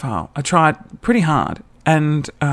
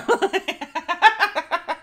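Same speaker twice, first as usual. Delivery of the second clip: long burst of high giggles; high cackling laugh; 14 semitones higher, 3 dB quieter than usual. Real, not acted. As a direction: crack up completely. Laughs at own joke.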